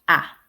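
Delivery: ah very short, as in "hat"; very short vowel. Shown as a demonstration. Teacher models the vowel in "hat", very short ah. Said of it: "Just very short".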